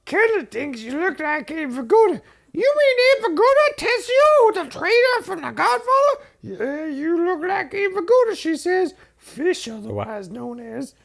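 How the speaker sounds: very high, far squeaky Muppet voices. Acted. Telling Jane about Abe Vigoda in very high Muppet voices. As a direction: do it like a Muppet show. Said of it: "I'm gonna do it in the form of Muppets".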